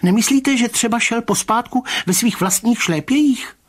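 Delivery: high, loud-edged excited question; agitated Mr. Rybka. Acted. Rybka suggesting a theory to the commissioner, high excited voice. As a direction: pitch it high and eager, grasping at a theory.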